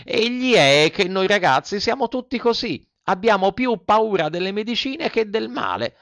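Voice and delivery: put-on high-pitched puppet boy; candid Pinocchio. Puppet explains boys' fear of medicine, childishly candid.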